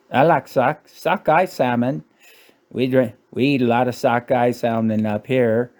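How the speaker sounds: hammed-up Canadian accent; mock Canadian accent. Canadian bragging about local sockeye salmon, mock Canadian accent. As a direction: put on a comic Canadian local's voice. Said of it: "they just sound like a fucking Canadian".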